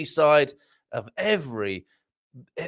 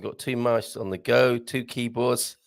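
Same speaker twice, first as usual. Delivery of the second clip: usual speaking voice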